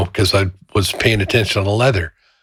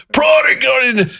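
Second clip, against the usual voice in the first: retold yell. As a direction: hurl it in anger. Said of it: "and he goes".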